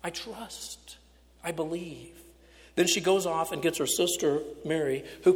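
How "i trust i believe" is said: quiet confession. Acted. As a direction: confess it gently and surely. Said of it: "big profession of faith".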